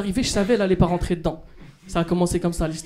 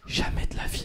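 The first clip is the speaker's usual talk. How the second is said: whispered; 7 dB quieter than usual. Retold or imitated. Chuchoté après la cliente.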